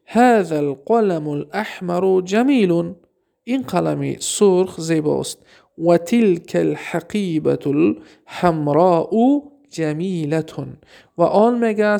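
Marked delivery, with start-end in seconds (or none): 0.1-2.9 slow, deliberate Arabic sentence; slow reading. Shown as a demonstration. Teacher dictating an Arabic sentence to students, slowly. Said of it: "teacher reads Arabic example". 5.8-10.4 very slow, drawn-out Arabic phrase; slow reading. Shown as a demonstration. Teacher reading an Arabic example sentence aloud, very slowly. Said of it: "teacher reads Arabic example".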